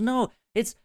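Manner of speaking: put-on high, loud drunk insistence. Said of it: "she goes".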